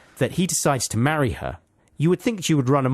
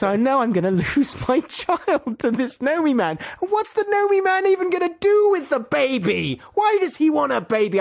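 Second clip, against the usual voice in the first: high-pitched comic dismay; hammed-up worried mother; mock panic; 14 semitones higher than usual. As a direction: fret aloud, pitched way up. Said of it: "she thinks".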